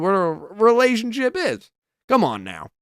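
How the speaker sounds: country accent. Real, not acted. Vlogger ranting at online commenters, heated country accent. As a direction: let the country accent slip out as you get worked up. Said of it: "I went into my country accent there".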